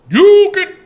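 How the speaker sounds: high, loud, stretched YUKIN; exaggerated name call. Repeats the name, playing it up.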